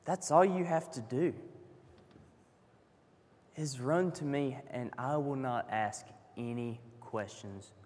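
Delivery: soft, low reassuring voice; a tender hushed promise; gentle Jesus. Acted. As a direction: reassure them quietly.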